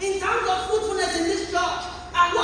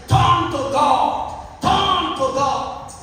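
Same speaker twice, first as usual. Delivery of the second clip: louder, slow, each word pressed; slow urgent appeal; 5 dB louder, much slower than usual. Acted. Preacher urging the congregation, slow and loud. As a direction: plead with them slowly.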